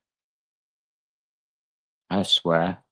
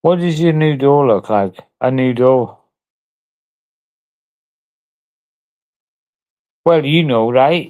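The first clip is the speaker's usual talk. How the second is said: louder and higher, exasperated; raised, irritated demand; 4 semitones higher, 5 dB louder than usual. Real, not acted. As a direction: push it out loud, fed up with the question. Angry rant about a door question.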